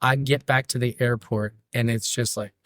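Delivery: ordinary delivery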